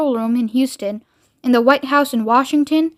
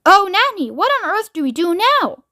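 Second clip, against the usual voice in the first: high, swooping helpless wail; crying out; 7 semitones higher than usual. A narrator's reading. President asking Nanny what to do, helpless cry. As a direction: wail for help like a fretful child. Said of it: "he cried".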